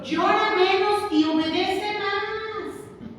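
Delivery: slow, with a laugh, "llora" stressed; half-laughing slow punchline, leaning on "llora"; playful slow punchline; slow laughing emphasis. Retold or imitated. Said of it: "dice el apóstol".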